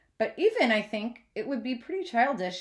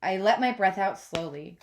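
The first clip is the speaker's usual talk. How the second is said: plain reading voice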